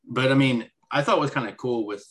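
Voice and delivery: usual speaking voice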